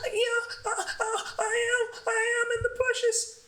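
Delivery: high, giggly mock whisper-whine; mock the drunk friend hiding, way up high; high drunk girl voice.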